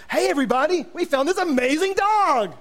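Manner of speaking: high, hammed-up sales pitch; gushing fake-excited announcement; mock enthusiasm. Overselling the dog on Facebook.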